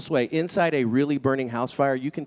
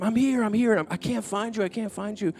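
higher, frantic calling out; panicked retold cry; 9 semitones higher than usual. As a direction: cry out in a panic, lost in the smoke. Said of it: "I'm panicking".

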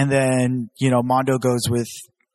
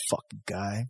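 much softer laughing mutter under the breath; muttered grudge; 6 semitones lower, 11 dB quieter, slower than usual. Retold or imitated. Remembering the old grudge mid-chat, soft laughing mutter. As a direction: grumble it to yourself through a laugh.

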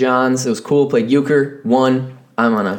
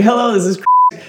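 higher, fast, clipped-sounding mock phone greeting; grumpy phone greeting; 12 semitones higher, faster than usual. Answering work calls while starving, hurried higher phone greeting. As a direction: rattle off the greeting like an annoyed receptionist. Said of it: "I'd be like".

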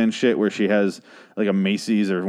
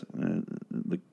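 whispered aside; 4 semitones lower, 13 dB quieter than usual. Real, not acted. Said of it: "aside under breath".

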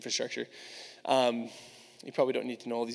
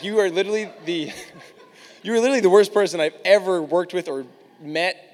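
higher, louder telling-off broken by laughs; mock tirade, cracking up partway through; laughing retold rant; 4 semitones higher, 8 dB louder than usual. Telling a coworker off in a retold fight, laughing rant.

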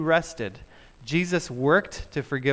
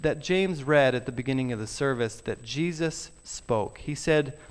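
ordinary delivery

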